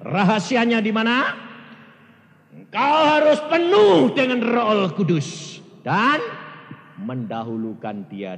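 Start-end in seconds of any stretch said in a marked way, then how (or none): 0.1-6.2 loud, high scripture proclamation; preaching at full force. Acted. Preacher quoting Jesus to the congregation, loud. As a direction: proclaim the word to the hall.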